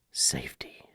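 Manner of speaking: whispered under the breath. Real, not acted. Trainer whispering the word safety to the viewers.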